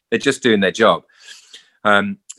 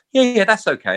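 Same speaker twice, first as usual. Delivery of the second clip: self-satisfied; 11 semitones higher than usual. Retold or imitated. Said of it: "I look and I go".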